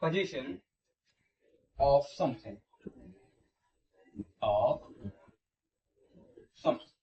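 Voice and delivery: dictation pace. Shown as a demonstration. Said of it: "लिखेंगे".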